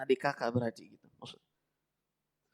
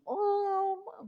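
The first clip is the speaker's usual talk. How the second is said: very high, held, soft mourning howl; mock sobbing wail; 5 dB quieter than usual. Preacher mimicking forbidden mourning over the dead, mock wail. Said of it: "kemudian dinangisi terseduh-seduh".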